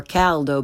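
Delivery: "caldo" with English short a as in cat; learner's mispronunciation. Shown as a demonstration. Teacher shows second mistake, "caldo" with English short a. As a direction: say "caldo" starting like Cal. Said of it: "first the way most people".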